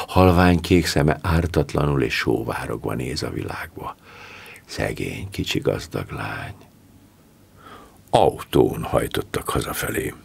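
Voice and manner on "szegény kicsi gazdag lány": 1 wistful murmur. A narrator's reading. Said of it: "a hajdani slágerre emlékeztette".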